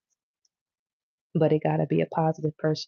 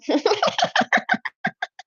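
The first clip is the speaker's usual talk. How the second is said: loud squealing laugh; real giggle fit; high laugh; 4 dB louder than usual. Real, not acted. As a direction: burst into a high-pitched cackle. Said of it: "laughing at own craving".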